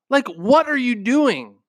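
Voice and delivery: high, slow, incredulous challenge. Real, not acted. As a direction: demand it in disbelief. Calling out the listener.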